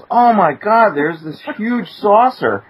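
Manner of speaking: high, slow gasp of amazement; staring at a huge saucer outside; retold awe.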